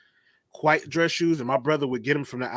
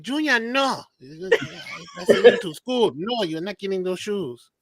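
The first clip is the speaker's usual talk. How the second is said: put-on high Haitian accent, scolding with a laugh; mock Haitian mother shutting it down; Haitian mom accent; 7 semitones higher, slower than usual. Haitian accent, mother refuses Junior's request for Huaraches. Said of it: "his mom be like".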